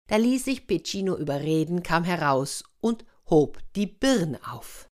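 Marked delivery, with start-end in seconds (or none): none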